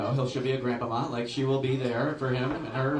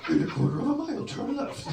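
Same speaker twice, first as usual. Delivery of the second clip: higher, gruff monster voice; Cookie Monster voice; 12 semitones higher than usual. Retold or imitated. GPS as Cookie Monster gives directions, monster voice. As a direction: growl the directions like a puppet monster. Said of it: "I think it's Cookie Monster".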